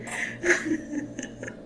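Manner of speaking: high, soft trailing giggles; long breathy run of laughter; amused laugh. Real, not acted. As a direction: keep cracking up. Laughs after imitating Fai.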